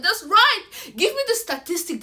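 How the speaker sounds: excited approval. Retold or imitated.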